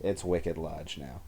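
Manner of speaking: put-on slow Boston accent with dropped R. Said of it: "Boston accent again".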